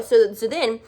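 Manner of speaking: normal talking voice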